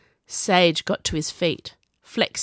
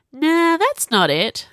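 easygoing, higher drawn-out dismissal; easy, casual; 10 semitones higher, 5 dB louder, slower than usual. A narrator's reading. Sage shrugging off Viola's explanation, easy and casual. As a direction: brush it off lightly, taking your time. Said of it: "said easily".

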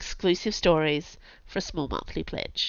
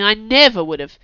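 dry, loud mocking retort; sarcastic; 3 semitones higher, 10 dB louder than usual. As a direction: sneer it back. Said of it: "said Chase sarcastically".

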